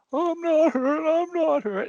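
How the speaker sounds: high, wailing mock sob of an old man; crying old man. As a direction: whimper it out in a high, hammed-up old man's cry. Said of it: "And he kept crying".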